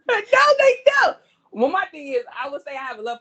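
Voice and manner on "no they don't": sudden high, loud denial; loud protest. Real, not acted. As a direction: shoot it down fast and loud. Protesting friend's claim.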